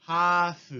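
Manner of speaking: half as hafu, no l; Japanese loanword. Shown as a demonstration. Teacher giving the Japanese form of half: ハーフ. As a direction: drop the l from half. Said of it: "se vuelve en".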